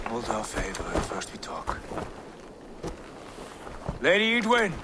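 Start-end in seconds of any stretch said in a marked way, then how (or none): 0.1-1.8 hushed whisper to his own side. Acted. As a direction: keep it secret, just breath. Quiet aside before parley.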